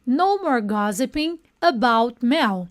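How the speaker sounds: slow, clear line to repeat; slow model for repetition. Shown as a demonstration. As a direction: give it slowly so they can echo. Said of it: "Repeat after me". (devagar, para os alunos repetirem)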